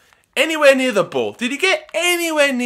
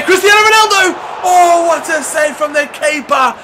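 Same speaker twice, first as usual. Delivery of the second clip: very high shout of the name, then a loud groan of disbelief; excited yell; 9 semitones higher, 5 dB louder than usual. Real, not acted. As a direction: scream the chance, then despair at the save. Late chance saved.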